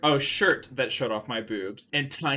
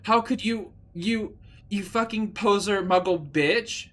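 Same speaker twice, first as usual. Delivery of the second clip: furious insult; 7 semitones higher than usual. Acted. Draco insulting Ebony.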